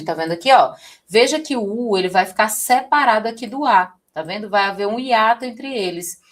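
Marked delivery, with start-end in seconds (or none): none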